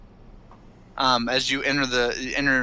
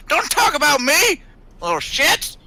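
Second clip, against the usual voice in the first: put-on high, loud Southern-accented leprechaun shout; 12 semitones higher, 5 dB louder than usual. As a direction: yell it as an offended leprechaun with a Southern twang. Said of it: "we hear a southern leprechaun".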